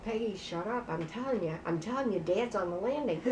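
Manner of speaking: very soft, low, urgent hush; secretive murmur, imitating night-time panic; hushed warning. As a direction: warn her under your breath. Retold secret warning to sister.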